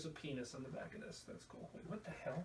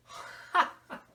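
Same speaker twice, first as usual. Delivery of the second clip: loud sudden laugh; loud scoffing laugh; burst of laughter; 12 dB louder than usual. Real, not acted. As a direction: crack up at the price. Laughing at price.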